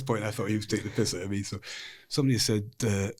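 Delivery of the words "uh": ordinary talk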